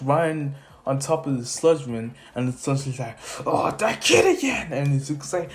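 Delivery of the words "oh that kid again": higher, exasperated outburst; annoyed villain. Retold or imitated. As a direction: groan at the pest's return. Sludgeman annoyed at returning kid.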